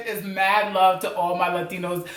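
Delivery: usual speaking voice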